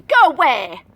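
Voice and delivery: loud dismissive; mildly cross brush-off; go away. Acted. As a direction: send him off. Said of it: "said Wee Willie Winkie".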